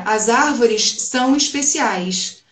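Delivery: "As árvores são especiais" slow, with carioca hushed S; Rio accent. Shown as a demonstration. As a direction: say it slowly like a carioca, S as sh. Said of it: "pra gente aqui no Rio de Janeiro seria". (sotaque carioca, devagar)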